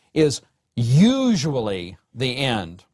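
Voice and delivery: "is usually the end" slowed down, leaning on "the end"; stress on the end. Shown as a demonstration. Professor reading Strunk's rule, stressing the end phrase. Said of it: "emphasis mine".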